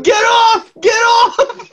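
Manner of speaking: high-pitched mock shout; imitated yelling. Friend imagines the injured player under the pile, mock yelling. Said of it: "were you yelling".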